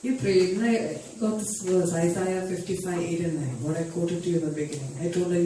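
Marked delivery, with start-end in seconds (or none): none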